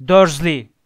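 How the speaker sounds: loud, booming call of the name; sudden angry shout; thundering. A narrator's reading. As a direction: bellow it in outrage. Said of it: "diye gürledi".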